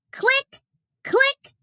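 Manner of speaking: high bright mock camera click. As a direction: pop out a tiny click.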